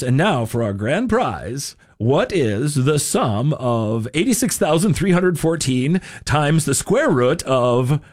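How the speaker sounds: slow, deliberate quizmaster delivery; game show announcer. Retold or imitated. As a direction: imitate a TV host posing the grand-prize question. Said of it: "the announcer, he says".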